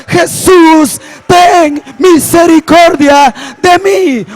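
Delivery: preacher acting out a plea for mercy; drawn-out imitated cry from the pulpit. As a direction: call out slowly with stretched words. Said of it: "empezó a dar voces diciendo".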